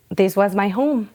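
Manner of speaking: normal talking voice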